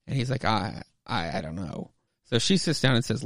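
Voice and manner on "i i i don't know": low, soft stammer; hesitant reply. Retold or imitated. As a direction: falter quietly, as if unsure. Hesitant boy answering her.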